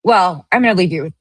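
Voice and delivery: usual speaking voice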